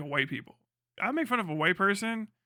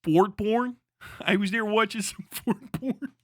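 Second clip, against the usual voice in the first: high, amused Baltimore "fort porn"; Baltimore accent; 5 semitones higher, slower than usual. Acted. Streamer trying the Baltimore way of saying it, amused Baltimore accent. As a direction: say "fort porn" with mock Baltimore vowels, pitched up. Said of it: "Baltimore accent is crazy".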